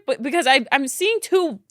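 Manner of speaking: ordinary talk, nothing marked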